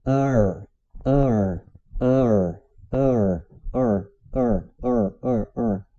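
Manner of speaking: "ar" repeated, the two sounds closing into one; shrinking gap. Shown as a demonstration. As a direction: drill "ar" from drawn-out to short and quick. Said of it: "Eu ia diminuindo esse espaço".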